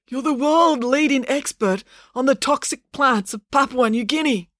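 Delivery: high, strained character voice; wounded professor, insistent. Acted. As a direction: insist urgently through weakness.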